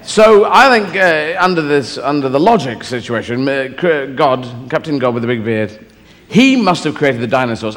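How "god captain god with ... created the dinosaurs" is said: ordinary talk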